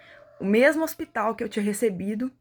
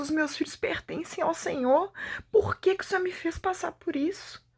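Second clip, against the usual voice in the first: anguished pleading prayer; 7 semitones higher than usual. Retold or imitated. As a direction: voice pitched much higher, pleading with God in distress. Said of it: "comecei a orar e falar". (oração aflita e suplicante)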